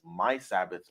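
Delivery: "my" hit firmly before "Sabbaths"; single out "my" in "my Sabbaths"; stress on my. Shown as a demonstration. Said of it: "I put emphasis where it says".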